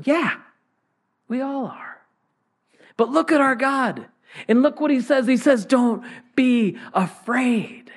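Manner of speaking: high, impassioned preaching; rising exhortation. Real, not acted. Pastor urging the congregation not to fear, high and impassioned. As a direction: lift them up with the promise.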